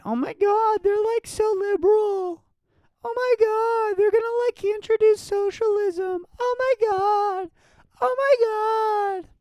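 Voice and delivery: very high, loud shrieking parody voice; mock hysterical panic. Comic mocking people freaking out about socialism, high shriek.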